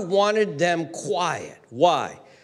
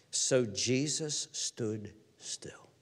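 slow, soft, low delivery; near-whispered scripture reading; hushed reading; 6 semitones lower, 9 dB quieter, much slower than usual. Real, not acted. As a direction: let the verse land slowly and quietly. Hushed reading of key verse.

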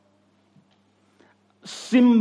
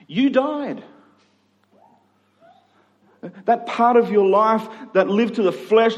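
preaching at full force; 6 semitones higher than usual. Acted. Pastor pressing the church to grasp they died, preaching hard. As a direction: press the point home from the pulpit.

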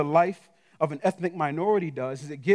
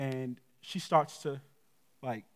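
usual speaking voice

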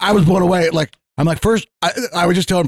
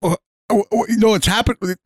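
high, hurried oh-oh-oh fluster; mock-panicked backpedalling; flustered denial; 6 semitones higher than usual. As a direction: sputter it out like you're caught off guard. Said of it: "I'm like".

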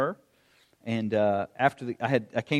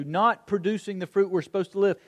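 ordinary talk, nothing marked

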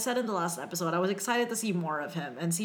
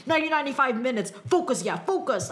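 put-on a rough, half-hearted French accent, louder, higher and fast; bad French accent; 6 semitones higher, 4 dB louder than usual. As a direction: imitate the captain's clipped French-accented pep talk, badly. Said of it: "I can't even do the French accent".

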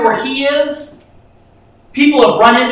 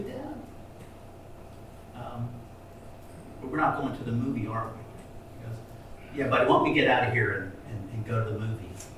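much softer and lower, gently comforting a scared son; tender, hushed father; 10 semitones lower, 15 dB quieter, faster than usual. Acted. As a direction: speak softly like calming a frightened child. Dad gently comforts crying son.

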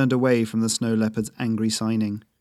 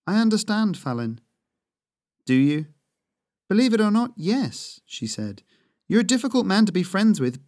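higher, gentle reassuring huntress; Yuff consoling; 6 semitones higher than usual. Acted. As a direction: comfort him, then nudge him along. Yuff voiced, higher throughout.

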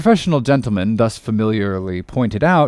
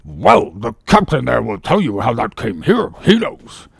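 ship surgeon. Acted. Surgeon appealing to captain about the dent, pompous. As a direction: hand the accusation over, self-important.

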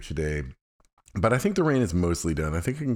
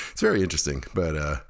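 ordinary delivery

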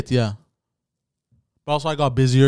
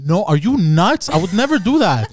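loud, high, incredulous denial; indignant denial; 6 semitones higher, 6 dB louder than usual. Real, not acted. Man denying her teasing question, indignant. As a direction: protest loudly, pitch jumping up.

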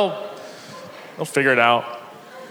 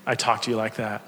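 sincere apology; 7 semitones lower, 5 dB quieter than usual. Pretend. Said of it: "give it to him and say".

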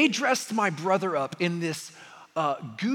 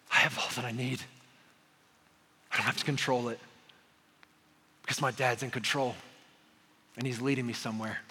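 low, soft reassurance; calm trust; 7 semitones lower, 7 dB quieter, faster than usual. Real, not acted. Preacher voicing trust to congregation, soft and low. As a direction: settle into quiet certainty.

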